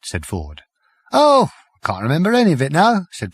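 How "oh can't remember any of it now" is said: high, fast and giggly; high quick Prack. A narrator's reading. Prack to Ford, high and careless. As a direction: toss it off lightly and high.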